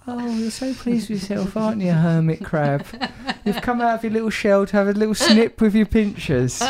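playful mock taunt; mock teasing. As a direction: needle her like a pesky crab. Teasing Jenny as a hermit crab.

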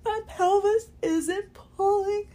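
tearful, high, voice cracking; crying through the words. Real, not acted. Relief from pain, tears.

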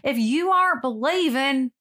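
mock Southern preacher working up the crowd; imitating a creepy Southern minister's drawl. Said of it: "he talks with the Southern drawl when he says".

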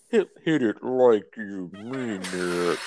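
slow, stretched-out egging on; slow-motion cheer for a friend taking a hit; drawn-out urging. Real, not acted. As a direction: drag the words out in an altered voice. Repeats it drawn out while smoking.

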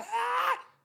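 very high imitated scream; mock shriek. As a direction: squeal like a scandalized listener, exaggerated. Mimics people who will be outraged. (chillido agudo de escándalo fingido)

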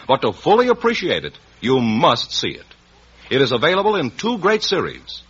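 polished, glowing radio commercial voice; ad read. Acted. Announcer selling the new DeSoto to radio listeners, polished ad read. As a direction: sell the car like a 1950s announcer.

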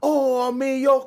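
high, hammed-up fake Japanese accent; mock Japanese accent. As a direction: imitate a comic actor's bad Japanese accent. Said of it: "like, this a Japanese accent".

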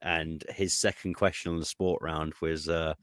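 usual speaking voice

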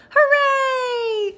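very high, loud cheer; delighted squeal. A narrator's reading. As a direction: squeal it like a thrilled little bulldozer. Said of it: "squealed Bulldozer".

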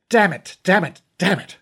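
frustrated cursing. Acted. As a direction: curse it under rising frustration. Fergal finds blade stolen.

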